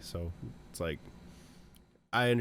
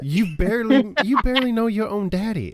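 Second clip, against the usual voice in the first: teasing; 10 semitones higher, 6 dB louder than usual. Real, not acted. Teasing argument with other player.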